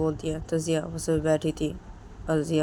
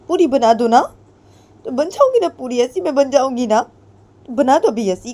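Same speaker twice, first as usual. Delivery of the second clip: much higher and louder; angry taunting; 9 semitones higher, 8 dB louder than usual. Acted. Ritu taunting Jiya, much higher and louder. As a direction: push back defiantly.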